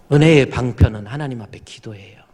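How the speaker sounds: ordinary talk, nothing marked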